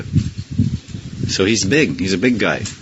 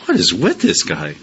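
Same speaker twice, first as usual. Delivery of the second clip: put-on higher, incredulous young friend; voicing Gadadhar; 5 semitones higher than usual. Gadadhar protesting to his teacher about the Acharya, higher and incredulous. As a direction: play the baffled childhood friend, pitched up. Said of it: "Gadadhar Pandit says".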